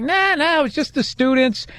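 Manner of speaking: high-pitched, dismissive cab driver impression; imitated Iranian cab driver. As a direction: brush it off as a retold cabbie. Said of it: "an Iranian cab driver try to tell me".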